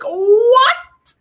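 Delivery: shocked high squeal of what; outraged disbelief, voice jumping up; high disbelieving cry. Real, not acted. Vlogger reacting to an eviction, in a high disbelieving cry.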